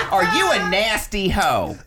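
a jokey sendup of a street accent. Shown as a demonstration.

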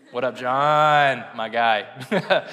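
loud, drawn-out name, then easing off; playful shout-out. Pretend. Preacher greets his favorite preacher who might hear this, playful shout-out. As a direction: holler a slow, playful greeting.

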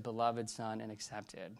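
softly reassuring father; tender God's voice. Retold or imitated. God's answer, low and slow.

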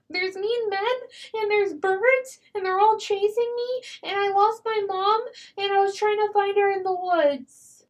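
put-on high, pleading little-kid voice; lost child act. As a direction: play a frightened lost child begging to come in. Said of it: "pretend to be a lost child".

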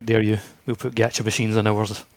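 ordinary delivery

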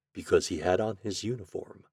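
plain reading voice

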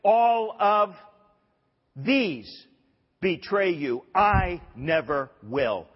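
loud, slow, bold pledge of loyalty; emphatic boast. Acted. Peter vowing loyalty to Jesus, boastful and emphatic. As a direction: declare it boldly, drawing out the key words.

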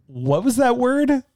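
high, slow, imitated questioning; puzzled British director. British director questioning the actor's word, imitated high puzzlement. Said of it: "who is British".

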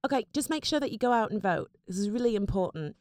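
put-on British girl's accent; earnest appeal in a British accent. Shown as a demonstration. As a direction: do a British girl pleading to listeners. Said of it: "Here, I'll do one for you".

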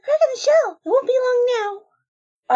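high excited exclaim; breathless with anticipation. Acted. Egg cracking.

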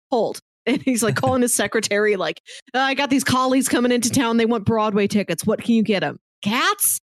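ordinary talk, nothing marked

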